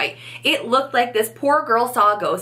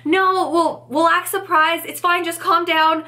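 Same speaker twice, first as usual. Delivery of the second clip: high, flustered it's fine; panicked reassurance; 7 semitones higher than usual. Retold or imitated. Mum calming the tearful clerk, in high flustered panic. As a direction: soothe her while panicking yourself. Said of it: "I'm panicking".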